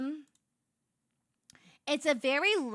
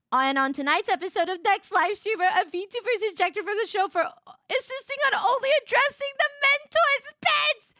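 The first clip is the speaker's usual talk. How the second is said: high, loud game-show announcer voice; mock announcer; 10 semitones higher, 3 dB louder than usual. Streamer joking about herself as a show contestant. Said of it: "mock TV announcer intro".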